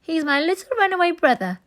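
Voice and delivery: high, fast, sugary announcement; Nancy lying to the crowd, sweet and false; quick, high, honeyed pretence; silky false voice. A narrator's reading. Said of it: "in a silky false voice".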